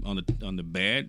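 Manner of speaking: ordinary talk, nothing marked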